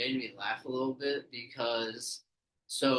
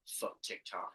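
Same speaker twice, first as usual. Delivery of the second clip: muttered curse; 4 semitones lower, 11 dB quieter than usual. Retold or imitated. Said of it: "a little bit just like".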